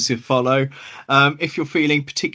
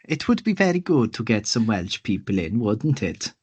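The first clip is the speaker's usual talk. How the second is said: bad Welsh accent that drifts towards Indian; hammed-up Welsh accent, badly faked; mock Welsh accent; 3 semitones lower than usual. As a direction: put on a comic English person's attempt at Welsh. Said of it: "to make a Welsh accent, they sound like an Indian".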